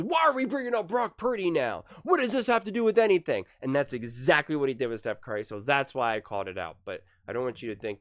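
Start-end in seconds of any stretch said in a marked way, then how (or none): 0.0-3.4 high-pitched mock yell; Nick yelling. Host mimicking Nick yelling at Brew, high mock yell. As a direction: imitate someone yelling in indignant protest. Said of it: "He always yells at Brew or whoever".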